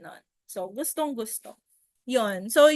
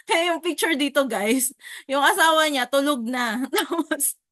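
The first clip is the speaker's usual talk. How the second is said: laughing through the story, higher; 3 semitones higher, 4 dB louder than usual. Real, not acted. Agent laughing that the client's wife fell asleep. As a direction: giggle between the words at the absurd scene. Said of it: "So, nakakatawa lang".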